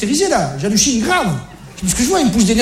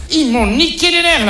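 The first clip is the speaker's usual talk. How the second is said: ordinary talk, nothing marked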